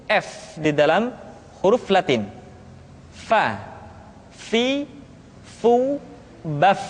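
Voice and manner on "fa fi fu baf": "Fa, fi, fu, baf" with air flowing gently through f; soft airy f. Shown as a demonstration. Teacher demonstrating the letter fa, gentle airflow on "baf". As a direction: say "Fa, fi, fu, baf" softly releasing breath on each f. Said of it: "Suara dan angin mesti keluar dengan lembut".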